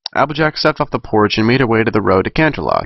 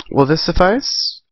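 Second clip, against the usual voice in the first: Twilight's voice; 7 semitones higher, slower than usual. Acted. Twilight voiced in read story.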